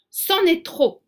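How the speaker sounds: high, loud offended snap; indignant outburst. A narrator's reading. The blackbird fed up with his doubting passengers, indignant. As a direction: bristle with wounded pride. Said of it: "s'indigne Zigomar".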